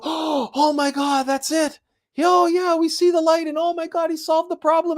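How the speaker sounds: high, delighted gasp of discovery; excited realization. Pretend. The crowd finally gets your point, high and delighted. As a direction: everyone suddenly sees the light, thrilled. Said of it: "they're gonna go".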